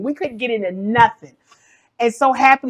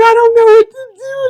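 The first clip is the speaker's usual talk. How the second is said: high, loud distressed plea; half-laughing, mock tearful; upset; 13 semitones higher, 9 dB louder than usual. Mother telling her son she is lost and upset. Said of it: "And I'm crying".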